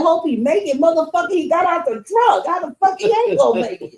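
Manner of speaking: loud, high, fast outraged retort; indignant shout back. Retold or imitated. Man yelling back at the detective, outraged. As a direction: fire it back at him, incredulous.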